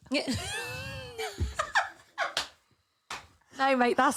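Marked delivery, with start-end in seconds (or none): 0.3-1.9 high screaming burst of laughter; shrieking laugh. Real, not acted. Cohost hears the "no holes barred" mistake, shrieking laughter.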